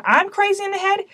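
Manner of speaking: a sharp high disbelieving echo of the insult; incredulous retort. Retold or imitated. To the teacher who called her crazy, incredulous high retort. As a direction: throw the insult back in disbelief.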